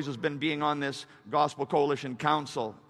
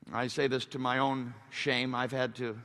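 ordinary talk, nothing marked

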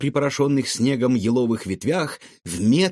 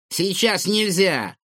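grumbling; 8 semitones higher, slower than usual. A narrator's reading. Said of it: "буркнула Лиза".

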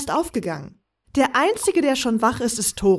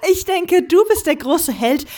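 higher, louder, amused mockery; laughing tease; 7 semitones higher, 4 dB louder than usual. Velaya teases him about fighting orcs, laughing. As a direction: tease the great hero through laughter. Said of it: "Velaya lachte".

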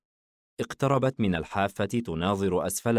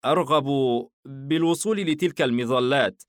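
flustered; 6 semitones higher, 3 dB louder than usual. Acted. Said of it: "حاييم بارتباك".

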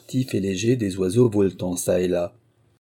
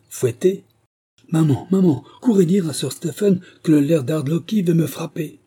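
high, loud furious shriek; furious child crying out; furious defiant cry; 8 semitones higher, 5 dB louder than usual. A narrator's reading. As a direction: scream for his mother in rage. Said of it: "tremblant de fureur".

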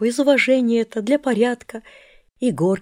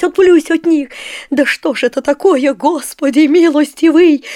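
loud, higher panicked pleading; fearful frenzy; 4 semitones higher, 9 dB louder than usual. A narrator's reading. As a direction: fight off the evil spirits in terror. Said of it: "зашептал страшным голосом". (Горкин в страхе заклинает мальчика: громко и испуганно)

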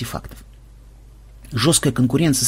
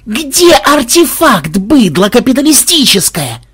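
a sudden, furious roar, higher and louder; roaring yell; 10 semitones higher, 5 dB louder, slower than usual. Acted. Programmer roars at the thief, furious shout. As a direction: bellow it so it echoes through the cellar. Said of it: "он заорал так".

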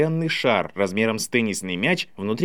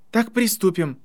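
high, ceremonial announcement; proclaimed; 7 semitones higher than usual. A narrator's reading. Harry opens the contest, proclaiming. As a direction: proclaim the start. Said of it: "провозгласил Гарри".

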